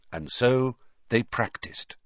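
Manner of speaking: ordinary delivery, nothing marked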